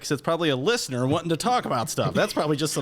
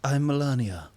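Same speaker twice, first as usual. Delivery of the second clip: put-on Melania accent; 3 semitones lower, slower than usual. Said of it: "Do you have a Melania imitation, D?".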